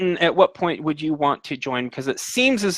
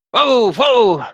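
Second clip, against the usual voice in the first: loud call to halt horses; shouting whoa; 7 semitones higher, 5 dB louder than usual. Acted. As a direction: yell at the horses to stop. Old man halts horses.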